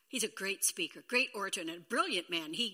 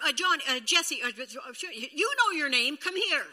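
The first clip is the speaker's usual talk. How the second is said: flustered grandparent; 4 semitones higher, 9 dB louder than usual. Retold or imitated. Fumbling grandkids' names.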